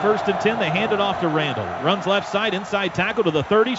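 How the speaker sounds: ordinary talk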